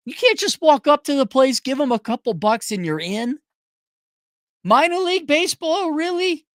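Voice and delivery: high-pitched disbelief; incredulous. Real, not acted. Fan balking at minor league ticket apps, incredulous. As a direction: raise the pitch in baffled protest.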